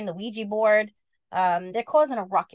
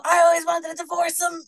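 higher, louder shouted line; zombie yell; 7 semitones higher, 5 dB louder than usual. Acted. Zombie Jada yells her grievance, shouted. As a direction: wail it at the boys.

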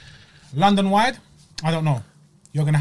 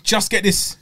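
impatient; 4 semitones higher, much slower than usual. Retold or imitated. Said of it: "I'm like".

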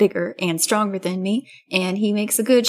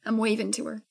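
normal talking voice

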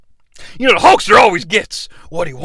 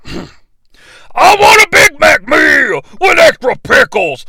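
slow, very loud, higher shouted order; Hulkmania voice; 6 semitones higher, 4 dB louder, slower than usual. Acted. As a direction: blast it out at full wrestler volume. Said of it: "my Hulkmania voice".

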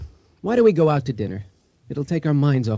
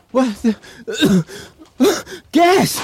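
higher, coughing, then louder gasped alarm; shocked discovery; 10 semitones higher, 6 dB louder, much slower than usual. Acted. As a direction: choke on the fumes and cry out. Husband discovers gas leak.

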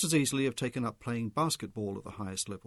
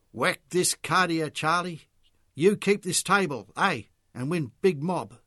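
supporter cheering; 5 semitones higher, 6 dB louder than usual. A narrator's reading. Supporter urging Charlie at the pool table, loud and eager. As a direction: egg Charlie on loudly.

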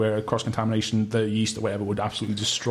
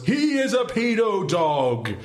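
much higher, slow declaration; general quote voice; 9 semitones higher, slower than usual. Acted. Host recaps a player's buzzer quote in character. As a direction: announce it gravely like a mad general. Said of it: "Your, uh, paedophile general quote was".

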